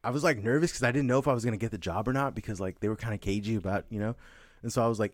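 ordinary talk, nothing marked

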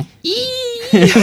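long, very high "eee" squeal; high-pitched squeal. Retold or imitated. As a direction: let out a shrill held comic squeal. Imitating film minions' squeal.

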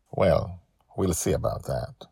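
usual speaking voice

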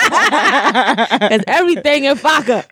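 slow, high, through laughter; laughing. Real, not acted. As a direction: say it while laughing. Laughing at own joke.

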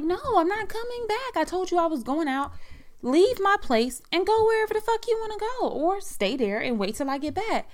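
high-pitched, indignant argument; retold defiant refusal. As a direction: act out snapping back at a demanding caller. Retold quarrel over the phone.

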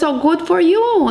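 lightly acted delight for someone else's luck; congratulating. Friend congratulating a lottery winner, congratulating.

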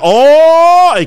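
long, high, loud drawn-out oh; a crowd's hyped oooh; excited oh. Retold or imitated. Crowd reacting to challenger.

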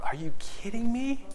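whispered disbelief, breathy and high; incredulous whisper. Retold or imitated. Listener reacting in whispered disbelief. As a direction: hiss it in shock at the idea. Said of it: "you're going".

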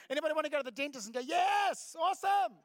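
loud, high, over-the-top cheer; mock enthusiasm. Joking about volunteering for the dentist, mock-thrilled shout. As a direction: whoop it up with absurd delight.

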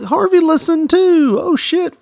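high, slow panicked gasp of alarm; shocked realization. Retold or imitated. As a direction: suddenly caught out, voice jumps up. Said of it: "I'm like".

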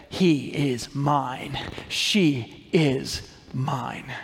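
lingering, tender, like claiming your own. Acted. Jesus claiming believers, slow and tender. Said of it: "Jesus will say".

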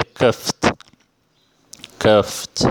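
cuffed ending in FT, "kuft". Shown as a demonstration. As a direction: say cuffed slowly with a released final T. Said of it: "mas se lê cuffed". (cuffed com o ED soando como T)

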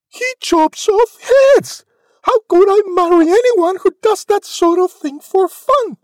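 very high, loud dismay; Cindy's anguish. A narrator's reading. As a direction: wail it high, aghast. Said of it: "Poor Cindy's heart was torn".

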